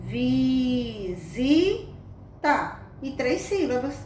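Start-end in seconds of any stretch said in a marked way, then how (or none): 0.0-1.9 VISITA slowly as VI, ZI, TA; long VI and ZI, short separate TA; syllable by syllable. Shown as a demonstration. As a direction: sound out each syllable of VISITA. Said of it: "Observaram como foi que a tia leu?".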